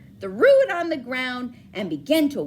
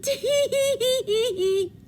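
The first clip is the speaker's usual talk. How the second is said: high, slow monkey giggle; teasing monkeys; 12 semitones higher, 3 dB louder than usual. Acted. Monkeys mocking the peddler with high slow giggles. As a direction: tease him like cheeky monkeys. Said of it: "the monkeys ... said".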